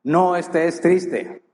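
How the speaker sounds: slow, stressing "No" in "No estés triste"; firm command. Acted. Preacher giving the biblical command, slow and firm. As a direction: lay down the command word by word. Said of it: "¿Cuál es el mandato?".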